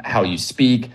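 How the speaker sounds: ordinary talk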